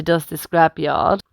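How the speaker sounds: plain reading voice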